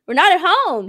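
loud scolding of a parent; exasperated protest. Retold or imitated. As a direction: raise the voice in embarrassed exasperation. Acted protest at loud mom.

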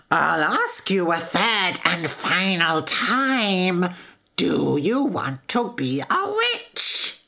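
slow, drawn-out witch voice; sinister, deliberate storybook witch; menacing witch. A narrator's reading. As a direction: question slowly like a threatening witch. Witch asks final time.

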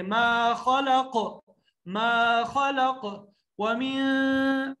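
ما خلق with a thick ق that bounces; slow recitation with a bouncing ق; qalqala on qaf. Shown as a demonstration. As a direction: stretch ما خلق, echo the final ق. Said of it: "قاف ... قلقلہ کریں گے".

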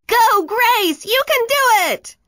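very high, loud cheer; cheering yell. Acted. Friend cheering Grace on before her kick, yelling. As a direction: shout her on from the sideline. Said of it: "yelled her friend Camilla".

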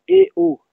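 "eo" split into two syllables, e then o, high. Shown as a demonstration. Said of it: "avec deux syllabes". ("eo" en deux syllabes, aigu)

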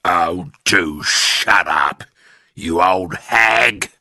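put-on slow, grumpy old man voice; Mister Twit. A narrator's reading. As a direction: brush her off, dragging the words. Husband snubbing wife.

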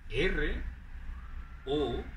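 ordinary talk, nothing marked

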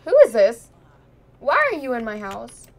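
loud, sharp demand at an intruder; who are you, get out of my house; indignant shout. Pretend. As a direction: shout at the stranger in your house. Voicing a Sim confronting mermaid.

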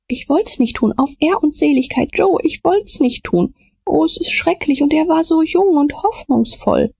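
high, desperate pleading; terrified pleading. A narrator's reading. Potter pleads, horrified. (hoch, verzweifelt flehend)

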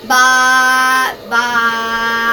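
loud drawn-out bye; loud sing-song bye. Retold or imitated. Mimicking video sign-off.

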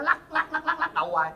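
high, teasing whine on each lắc; mocking whine. Son teasing his picky mother, high mocking whine. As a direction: needle his mother by mimicking her head shakes.

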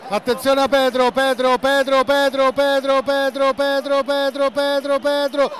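high, loud repeated name call; breathless italian goal commentary. Real, not acted. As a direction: scream the name as he runs. Pedro runs at goal. (telecronaca concitata da gol)

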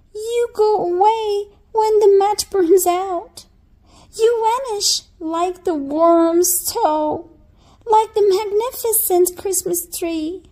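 put-on high, loud child's pleading; pleading little girl. A narrator's reading. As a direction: beg grandmother not to leave. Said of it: "cried the little girl".

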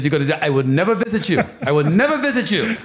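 high, loud West End scolding from mom; mom yelling in West End accent. Retold or imitated. Mother warns son she won't visit him in jail, high loud West End yell. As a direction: shout it like his West End mother laying down the law. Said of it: "in her West End accent".

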